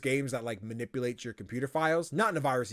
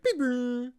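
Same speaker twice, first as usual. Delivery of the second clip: high-pitched computer error beeps; imitated error chime; 12 semitones higher, 4 dB louder than usual. Host showing the game's Windows pop-ups, imitated chime. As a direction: mimic a pop-up alert with high held tones. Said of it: "your Windows error".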